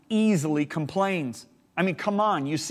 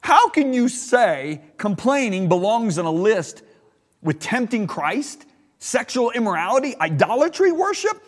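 high, loud indignant protest; upset protest; 6 semitones higher, 5 dB louder than usual. Retold or imitated. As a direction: retell the upset outcry at God. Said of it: "I actually got upset. I said".

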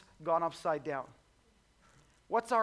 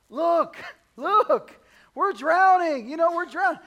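much higher, louder frantic alarm; panicked plea; 11 semitones higher, 13 dB louder than usual. Retold or imitated. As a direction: yelp that you're going under. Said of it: "wake him up and say".

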